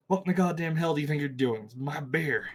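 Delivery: fast, angry barfly snarl; belligerent drunk. Acted. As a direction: snap at the thief in the bar. Angry bar patron confronts player.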